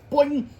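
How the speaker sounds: high, loud springy sound effect; cartoon sound effect; boing noise. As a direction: make it bounce like a spring.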